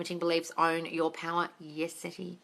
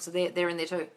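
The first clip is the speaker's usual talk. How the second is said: ordinary delivery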